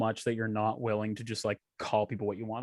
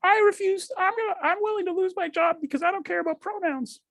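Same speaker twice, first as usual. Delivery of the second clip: much higher, strained mocking voice; mock Peterson refusing; Jordan Peterson impression. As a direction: whine it like an indignant professor. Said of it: "Jordan Peterson was like".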